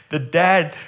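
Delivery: ordinary talk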